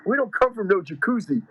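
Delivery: imitating a street-tough New Yorker, lightly; New York tough guy. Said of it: "he goes".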